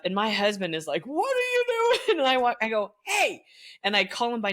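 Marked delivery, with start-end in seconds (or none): 1.1-1.8 high, startled husband voice; imitating a nervous husband, high and startled; husband's alarmed squeak. 3.0-3.4 sharp high call across the pit; mock stern shout to teens. Calling out to students.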